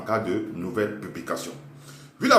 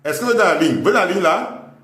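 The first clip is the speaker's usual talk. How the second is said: mock schoolteacher, louder; teacher checking class; 7 semitones higher, 6 dB louder, faster than usual. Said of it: "Vous écrivez".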